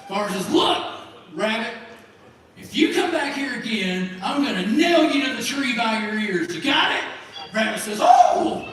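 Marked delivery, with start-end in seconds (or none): 0.5-7.0 raised voice, building to a sharp question; angry farmer threat. Acted. Farmer's final threat to rabbit. 8.0-8.6 very high, loud cartoon "oh"; terrified rabbit. Rabbit startled by bigger threat.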